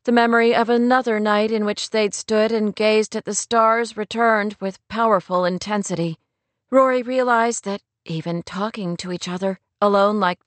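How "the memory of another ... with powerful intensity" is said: ordinary delivery, nothing marked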